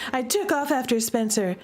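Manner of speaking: plain reading voice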